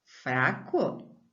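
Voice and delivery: usual speaking voice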